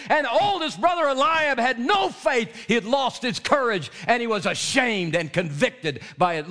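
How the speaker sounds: high, ringing preaching; preaching at full force. Acted. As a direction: drive the point home from the pulpit. Preaching to the men.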